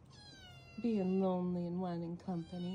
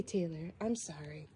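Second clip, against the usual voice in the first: usual speaking voice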